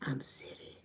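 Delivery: very soft, whispered low aside; hushed aside. Pretend. Joking about being city or country, whispered. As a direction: drop it to a near whisper.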